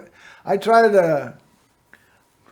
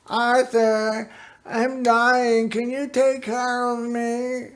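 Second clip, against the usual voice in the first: higher, weak pleading mother; frail mother voice; 5 semitones higher, 3 dB quieter, slower than usual. Retold or imitated. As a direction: plead softly like a sick old mom. Quoting his dying mother.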